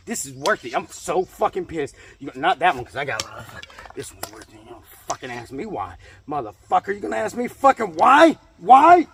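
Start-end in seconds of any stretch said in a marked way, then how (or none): 6.3-9.1 builds to a loud, high yell on why; furious shout. Acted. Ranting at viewers who ask why, exploding into a shout. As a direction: blow up at them on the last why. Mock rant, exploding second take.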